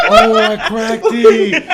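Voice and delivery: drawn-out, high, loud cry through laughter; laughing realization. Retold or imitated. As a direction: slowly wail it while cracking up. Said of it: "And I was like".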